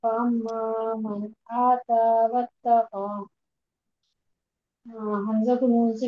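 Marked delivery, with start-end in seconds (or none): 0.0-3.3 slow, measured Quranic recitation; student continuing the verse. Shown as a demonstration. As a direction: carry on reciting slowly. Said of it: "जी".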